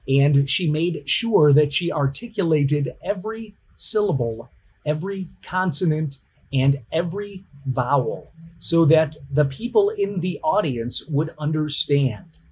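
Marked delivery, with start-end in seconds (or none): none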